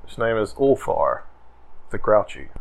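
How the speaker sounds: ordinary delivery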